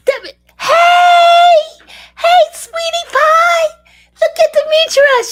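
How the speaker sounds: very high, loud, delighted cry of welcome; excited squealing greeting. Real, not acted. Greeting Demetra after months apart, squealing with excitement.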